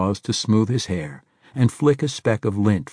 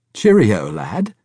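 put-on hearty old Englishman; 7 dB louder than usual. A narrator's reading.

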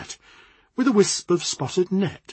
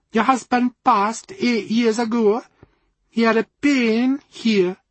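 put-on higher, slow Jamaican accent, solemn; 5 semitones higher, 3 dB louder than usual. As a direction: Jamaican fortune-teller, grave and sure. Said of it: "in a rich Jamaican accent".

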